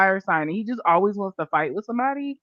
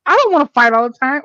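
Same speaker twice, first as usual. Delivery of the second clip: higher, louder mock denial; mock protest; 7 semitones higher, 8 dB louder than usual. Defending Libras with mock indignation, louder and higher. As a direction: protest too much, on purpose. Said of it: "Okay, maybe I do".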